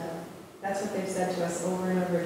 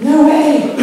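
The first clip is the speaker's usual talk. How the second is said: gasped refusal; 8 semitones higher, 15 dB louder than usual. Retold or imitated. Scared kids refuse to draw.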